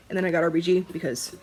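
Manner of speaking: normal talking voice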